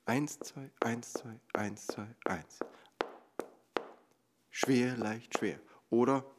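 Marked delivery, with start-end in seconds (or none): 0.1-2.6 eins betont, zwei gehaucht und leise; Zweiertakt zählen. Shown as a demonstration. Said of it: "von Betonung zu Betonung". (Zweiertakt leise zählen)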